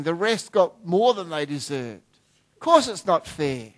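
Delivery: ordinary delivery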